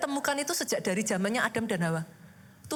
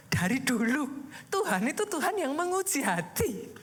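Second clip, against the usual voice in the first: ordinary talk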